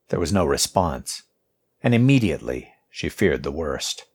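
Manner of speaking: plain reading voice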